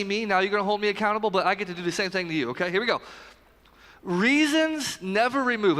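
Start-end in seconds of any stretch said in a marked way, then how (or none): 4.0-5.8 slow, higher, every word weighted; preacher's key line. Shown as a demonstration. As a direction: plant the phrase slowly for the room. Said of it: "Everybody say remove".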